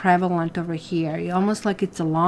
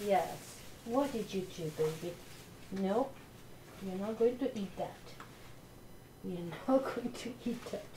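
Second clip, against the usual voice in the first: soft scolding to puppy; 15 dB quieter than usual. Real, not acted.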